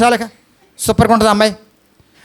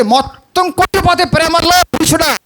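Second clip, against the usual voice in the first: high outburst; 7 semitones higher than usual. Real, not acted. Preacher's sudden outburst.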